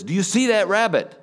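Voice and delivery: almost shout it, pushing; insistent demand. Retold or imitated. Neighbor insists a third time.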